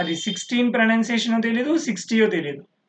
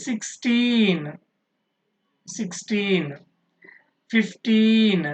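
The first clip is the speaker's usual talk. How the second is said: put-on sixteen and fifteen with a long, stressed teen; much slower than usual. Shown as a demonstration. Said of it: "But here, interesting one". (sixteen, fifteen లో teen ను సాగదీసి ఒత్తి పలకడం)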